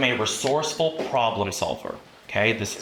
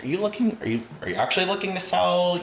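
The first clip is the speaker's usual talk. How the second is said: probing question, retold; 8 semitones higher than usual. Quotes own question to curious friend.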